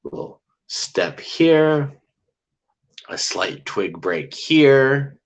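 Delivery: slow and high, drawing out each little sound; slow hushed build. Shown as a demonstration. Telling viewers of creeping footsteps, slow and drawn out. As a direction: tease out each faint step slowly. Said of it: "heard them slinking".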